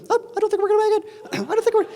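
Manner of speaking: very high, hammed-up panic squeak; panicky student. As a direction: squeak it out in mock terror. Panicking student on short final.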